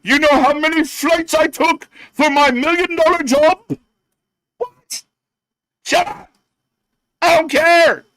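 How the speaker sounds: hammed-up crybaby voice; mocking whiny wrestler. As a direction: mimic a spoiled fighter complaining.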